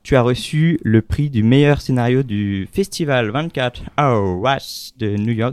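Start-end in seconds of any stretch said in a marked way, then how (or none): 4.0-5.5 "Hour Rush de New York" said slowly in a shaky, French-tinged English accent; slow, awkward English accent on "Hour Rush de New York"; clumsy English accent. Real, not acted. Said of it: "Désolé pour mon accent".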